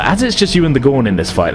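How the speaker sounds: usual speaking voice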